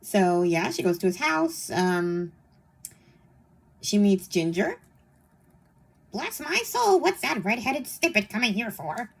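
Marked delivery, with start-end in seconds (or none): 6.1-9.1 high squawky parrot voice. Acted. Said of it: "You like my parrot voice?".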